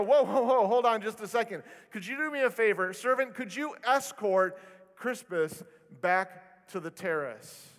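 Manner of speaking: politely turning a guest away, with a touch of snobbery; snobby host. Retold or imitated. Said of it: "you can hear guys go".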